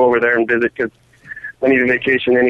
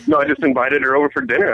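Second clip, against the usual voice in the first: ordinary delivery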